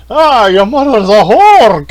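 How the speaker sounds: loud, high, drawn-out mock holler; old-timey insult. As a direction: bellow a hammed-up old-time curse. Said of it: "It was like this old-timey fucking".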